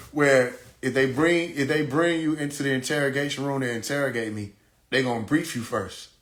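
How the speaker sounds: ordinary talk, nothing marked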